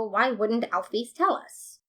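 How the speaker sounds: ordinary delivery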